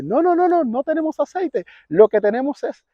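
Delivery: animated high voice for the host; homeowner's protest. Retold or imitated. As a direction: act out a flustered host explaining. Acting out the homeowner's anxious reply.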